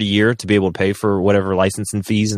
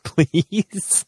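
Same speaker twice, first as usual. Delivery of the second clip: exasperated plea; 7 semitones higher than usual. Real, not acted. Exasperated plea about president.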